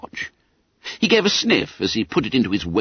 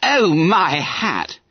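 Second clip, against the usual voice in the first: higher, slow astonished gasp; 8 semitones higher, much slower than usual. Acted. Bob Cherry gasping at Bunter's cheek. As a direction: gasp in amazement. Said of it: "gasped Bob Cherry".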